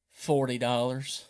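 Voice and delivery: a hint of a sly country old boy; imitating the landowner. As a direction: drop low and slow, sly price. Landowner naming his price.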